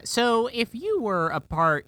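ordinary talk

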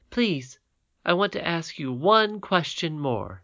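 a hint of a happy child; glad little princess. Irene voiced by the reader.

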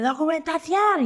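high, slow mock yell of a policeman; drawn-out mock shout of a police order; imitating a shouting cop. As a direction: bark out the demand for papers like a bossy cop. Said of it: "el tío me gritaba".

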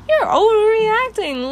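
high, loud, drawn-out mock whine; mocking mimicry. Vlogger imitating people who dismiss her anger, mocking.

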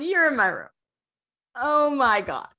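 a fangirl squeal of disbelief; squealing excitement. Retold or imitated. Shocked Thanatos is there.

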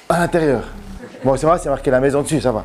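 ordinary delivery

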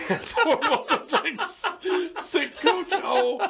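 high, helpless laughter through the words; laughing hard. Real, not acted. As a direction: crack up while trying to talk. Cracking up at the botched accent.

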